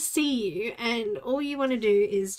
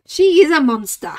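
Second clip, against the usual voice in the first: louder playful exclamation; mock outrage; 2 semitones higher, 12 dB louder than usual. Real, not acted. Complaining about her cat, a loud mock outburst.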